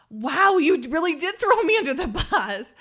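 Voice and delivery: very loud, high-pitched playful accusation; mock outrage. Real, not acted. Host accusing co-host who hasn't seen it either, loud mock outrage. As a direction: protest at full volume, voice shooting up.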